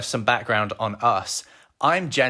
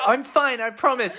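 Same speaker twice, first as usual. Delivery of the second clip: much higher, hammed-up insistent voice; mock girlfriend voice; 12 semitones higher, slower than usual. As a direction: tease her by faking her brave reassurance. Mocking girlfriend eating stale Pringles.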